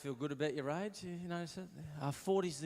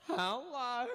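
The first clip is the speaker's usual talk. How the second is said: high-pitched mock greeting; silly foreigner's hello; 12 semitones higher than usual. How his bonjour sounds to the French, silly and high. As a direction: pitch it way up like a clueless tourist. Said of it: "To them, it probably sounds like".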